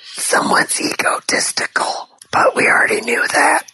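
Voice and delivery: loud whisper meant to be overheard; stage whisper. Real, not acted. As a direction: whisper it loudly as an aside. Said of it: "Why are you whispering, and why are you whispering loudly?".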